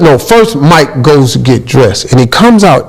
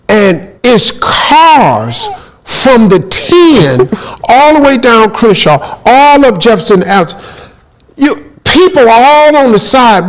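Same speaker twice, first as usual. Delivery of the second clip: higher and stretched out, building amazement; telling the host about the traffic jam, excited and higher; 7 semitones higher than usual. Real, not acted. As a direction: paint the endless line of cars, wide-eyed. Amazed at crowds on Crenshaw.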